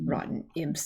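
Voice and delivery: hushed whisper under the breath; muttered aside. Acted. Cursing the imps who ruined the posters, whispered. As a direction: grumble it to yourself.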